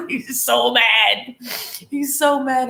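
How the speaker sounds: high, loud, tickled emphasis; amused exclaiming. Retold or imitated. Describing her twin's tantrum, amused exclaiming. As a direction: exclaim it with rising glee.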